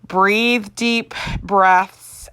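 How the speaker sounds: drawn-out, very slow calming instruction; a gentle nurse's very slow breathing cue; slow soothing coaching. Retold or imitated. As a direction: ease the patient into slow breathing. Anesthesia staff coaching her breathing.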